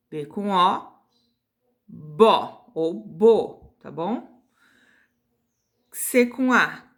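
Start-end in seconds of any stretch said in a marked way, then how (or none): 0.1-3.8 "bó ou bo" alongado e mais alto; sílaba bem lenta. Shown as a demonstration. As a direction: estica a sílaba devagar, ensinando. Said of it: "a gente vai falar as sílabas em voz alta". (professora alonga "bó ou bo" bem devagar)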